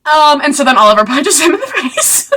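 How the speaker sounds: laughing through a high burst. Real, not acted. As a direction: crack up telling the punch. Laughing at the punch.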